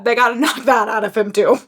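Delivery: usual speaking voice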